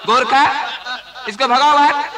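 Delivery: laughing order. Acted. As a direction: throw him out while cracking up. Director laughing, throwing actor out.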